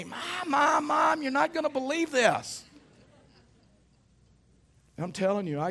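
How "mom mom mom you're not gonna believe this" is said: high-pitched excited child imitation, much higher; excited kid calling Mom. Kids running into the house waving money, excited high holler. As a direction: mimic a kid hollering breathless news to Mom.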